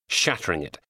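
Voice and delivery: usual speaking voice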